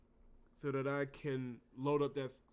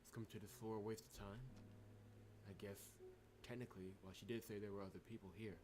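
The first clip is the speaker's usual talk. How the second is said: soft, low, uneasy in-character reading; game protagonist voice; 6 semitones lower, 12 dB quieter than usual. Acted. Player voicing the heroine exploring a frozen floor, soft and uneasy. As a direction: voice the wary hero exploring the floor.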